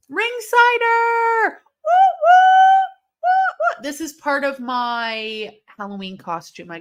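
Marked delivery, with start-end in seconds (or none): 0.1-1.6 drawn-out shout. Real, not acted. Excited call-out while watching wrestling. 1.8-3.8 very high, loud Ric Flair style woo; shrieking woo at the top of the voice; Flair woo yell. Retold or imitated. Ric Flair woo while in costume.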